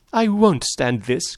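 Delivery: high, drawn-out woman's protest; distraught woman's cry. A narrator's reading. Daisy can't bear the men's quarrel, crying out. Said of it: "cried Daisy".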